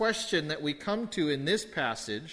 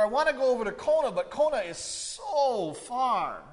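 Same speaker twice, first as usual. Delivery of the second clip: high, exaggerated, "so" drawn way out; emphatic everyday complaint with long "so"; stress on SO; 8 semitones higher than usual. Shown as a demonstration. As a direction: stretch "so" to stress the distance. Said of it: "that's kind of the way we read it".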